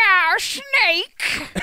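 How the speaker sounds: high, loud hammed-up villain call; Gray Fox voice. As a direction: belt his name like a cartoon villain. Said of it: "I'd be like".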